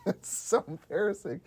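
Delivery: ordinary talk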